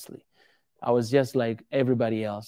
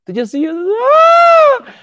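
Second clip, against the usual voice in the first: high loud mock child scream; mock scream of scared kids; 13 dB louder, slower than usual. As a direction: shriek it like a frightened kid. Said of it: "they start to cry".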